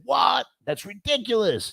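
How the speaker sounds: high-pitched, incredulous imitation of the boss; mock outrage at a price, voice jumping up; retelling the boss's shocked complaint about the price, high and indignant; indignant outburst. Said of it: "and he was cussing".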